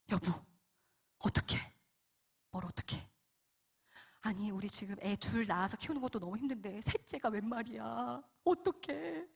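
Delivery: near-tears worry. Retold or imitated. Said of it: "거의 막 울상이었어요".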